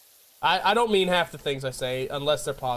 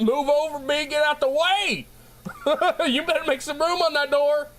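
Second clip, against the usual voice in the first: high-pitched comic yelling with a laugh; pushy, worked-up shout pitched way up; acted indignant demand; 11 semitones higher than usual. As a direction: order someone aside in mock panic. Acts out Titanic survivor demanding room.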